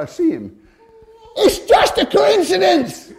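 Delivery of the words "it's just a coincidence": high, loud, drawn-out shout; shouting down the corridor. Retold or imitated. Boss shouting down a Scotland Yard corridor, a loud slow shout. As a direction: bellow it slowly down the corridor. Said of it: "he shouts down the corridor".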